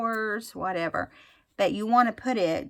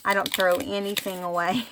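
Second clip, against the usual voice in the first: ordinary talk, nothing marked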